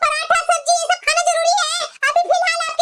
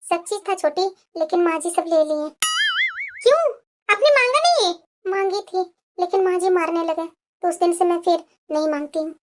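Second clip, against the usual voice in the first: meek elder daughter-in-law; 7 semitones lower than usual. Acted. Elder bahu tells of beatings.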